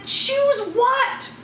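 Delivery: high, incredulous challenge; incredulous outrage. Retold or imitated. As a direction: throw it back, pitch leaping. Retold quarrel over choosing.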